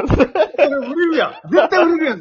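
high, laughing out the words. Real, not acted. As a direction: giggle while reacting. Amused reaction to joke.

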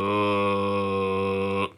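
extremely deep bass voice. Shown as a demonstration. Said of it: "その後向こうが一番低い音を出して".

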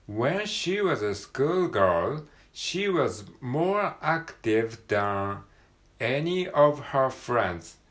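teacher reading aloud; slow model reading. Shown as a demonstration. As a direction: read each word out slowly. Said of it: "model reading".

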